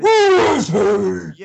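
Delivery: loud, high, slow mock Batman shout; hammed-up Batman yell. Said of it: "That's not the right voice".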